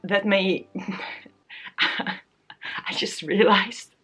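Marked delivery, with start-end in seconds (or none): 0.7-3.9 slow, breathy laugh through the words; laughing realization. Real, not acted. Vlogger laughing at herself, slowly realizing how weird she sounds. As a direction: laugh at yourself as you catch it. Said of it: "this sounds".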